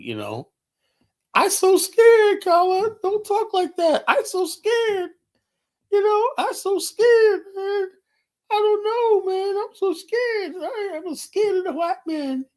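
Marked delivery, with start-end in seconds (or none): 1.3-12.5 very high, drawn-out whimper; hammed-up falsetto of a terrified coward; mock scared. Caller mocking a scared panelist, whiny mock-frightened falsetto. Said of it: "D K is a scary dude".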